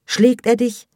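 high, loud probing question; alarmed demand. A narrator's reading. Peak of mother's questioning.

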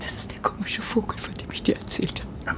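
conspiratorial whisper. Acted. Friend whispers to Lara about the odd guy. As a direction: lean in and whisper it.

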